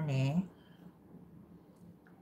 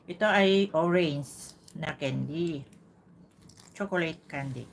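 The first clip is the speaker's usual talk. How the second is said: slow presenting; 4 dB louder, slower than usual. Acted.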